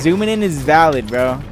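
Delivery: loud insistence. Real, not acted. Insisting again.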